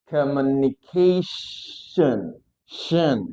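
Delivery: COMMUNICATION stretched, with a long sh before "shen"; very slow, broken-up COMMUNICATION; slow syllable demo. Shown as a demonstration. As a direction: linger on the SH, then repeat "shen". Teacher models word slowly.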